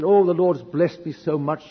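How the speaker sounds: acted overjoyed testimony; joyful praise. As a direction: gush with retold gratitude, drawing it out. Retold joyful praise to wife.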